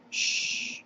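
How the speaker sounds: an unvoiced hiss to hush him; hissed shush. A narrator's reading. McGonagall hushing sobbing Hagrid, a hissed shush. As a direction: shush him sharply in a whisper. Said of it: "hissed Professor McGonagall".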